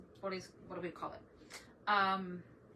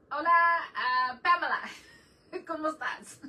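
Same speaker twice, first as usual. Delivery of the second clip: louder, higher call out to Pamela; cheerful loud greeting; 8 semitones higher, 12 dB louder than usual. Real, not acted. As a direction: hail a friend across the room. Greeting a viewer in chat.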